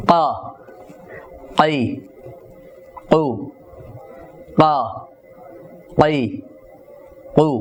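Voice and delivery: ক, কই, কু said with the tongue root hitting the palate, a jolt on each; qaf with qalqala. Shown as a demonstration. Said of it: "যেমন".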